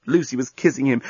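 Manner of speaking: usual speaking voice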